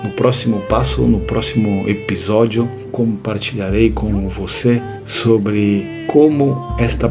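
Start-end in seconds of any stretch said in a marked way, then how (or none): none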